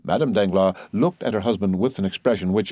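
ordinary delivery, nothing marked

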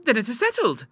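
put-on high, demure girlish voice. A narrator's reading. Clara closing the plan with her father, demurely. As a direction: say it primly, pitched high. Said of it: "said Clara demurely".

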